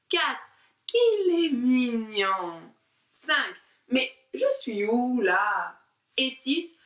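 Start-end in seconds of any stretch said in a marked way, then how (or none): 0.9-2.6 slow, louder cooing over mignon; cooing at a kitten. Shown as a demonstration. Teacher cooing over an imaginary kitten. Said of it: "caresse un petit chat". 3.9-5.8 slow, lost and wondering; puzzled. Shown as a demonstration. Teacher acting lost during the warm-up. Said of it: "vous dites".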